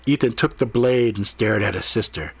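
ordinary delivery

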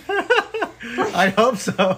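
laughing out the words. Real, not acted. Laughing reaction to story.

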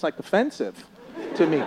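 ordinary delivery

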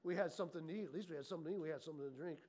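hushed, low muttered complaints; low grumbling. Acted. Preacher acts Israelites missing food and drink, low grumbling. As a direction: grumble to yourself, barely aloud. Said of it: "a low little grumbling process going on".

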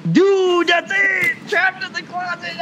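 high excited yell of discovery; excited shout. Real, not acted. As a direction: shout it like a eureka. Sudden idea for episode name.

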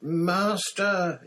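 loud, high-pitched shriek; terrified shriek. A narrator's reading. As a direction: shriek for mercy at your master's feet. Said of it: "he shrieked".

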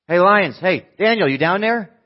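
loud, high call down into a pit. Acted. King calling anxiously down into the lions' den. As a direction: shout down into the dark.

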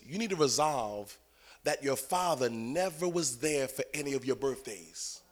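low, slow, hushed delivery; quiet revelation. Retold or imitated. Holy Spirit speaking to a praying believer, low hushed voice. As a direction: deliver it softly and gravely.

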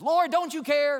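high, loud snap of complaint; snapping again. Retold or imitated. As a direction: bark it at Jesus in frustration. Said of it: "And she says".

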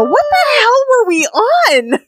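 high, drawn-out mock disbelief; hammed-up incredulous cry; retold incredulous reaction. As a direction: wail it in exaggerated bafflement. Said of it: "I listen to this shit and I go".

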